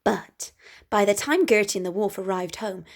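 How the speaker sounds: usual speaking voice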